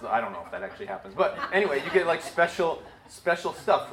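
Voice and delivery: fast, laughing aside; amused, giggly tone. Real, not acted. Laughing aside about airline perks.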